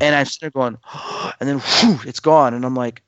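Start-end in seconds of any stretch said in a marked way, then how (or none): 0.8-1.4 breathless unvoiced gasp of shock; reliving a near-death gasp; sharp gasp. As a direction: suck in air as if you just saw it.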